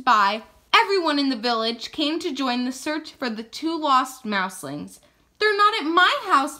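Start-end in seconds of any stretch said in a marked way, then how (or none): none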